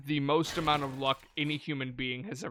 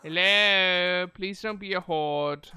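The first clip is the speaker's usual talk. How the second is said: louder, slow wary call; nervous loud hello; 3 semitones higher, 7 dB louder, slower than usual. Real, not acted. Player fearing a horde behind the door, loud wary call. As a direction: call out warily, hoping it's not many.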